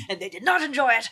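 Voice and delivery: laughing through the joke. Real, not acted. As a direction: stress on not, chuckling. Joking about grandmothers.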